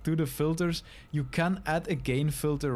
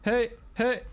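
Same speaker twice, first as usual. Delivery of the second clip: shouting; 7 semitones higher, slower than usual. Shown as a demonstration. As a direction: shout into the mic to test the peak. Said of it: "scream into your microphone".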